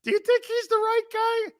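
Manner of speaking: squeaky falsetto with stammered starts; very high, hesitant caricature; high-pitched West Coast host. Shown as a demonstration. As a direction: ask it timidly in a mock San Francisco radio voice. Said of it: "I have to talk in that high-pitched voice".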